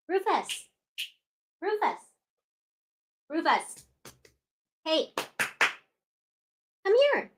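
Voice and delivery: high, soft sing-song pet call; high pet-talk calling Rufus to come over. Real, not acted. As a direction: coax the dog over sweetly.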